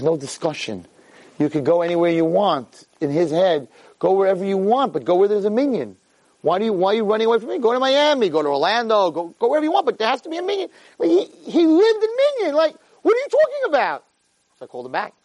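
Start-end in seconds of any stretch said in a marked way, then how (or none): 4.4-14.0 rising exasperation. Retold or imitated. Mimicking father's insistence, rising.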